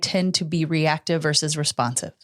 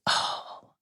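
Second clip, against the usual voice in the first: sad daughter; 8 semitones higher, 6 dB quieter than usual. Retold or imitated. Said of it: "she was sad".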